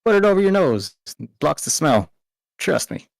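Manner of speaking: southern accent attempt. Varys in put-on voice.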